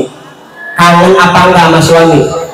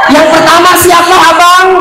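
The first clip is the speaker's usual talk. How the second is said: coy wife; 13 semitones higher than usual. Retold or imitated. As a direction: squeal it like a flattered wife. Wife coyly asks husband.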